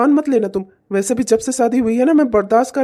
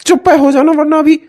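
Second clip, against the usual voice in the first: loud, higher barked warning; angry shout; 4 semitones higher, 8 dB louder than usual. Acted. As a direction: cut her off with a threat. Husband shouts at wife. (पत्नी पर चिल्लाता पति)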